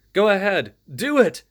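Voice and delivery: shouted while caught in a villain's grip; urgent gasped shout. Acted. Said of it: "gasped Ray".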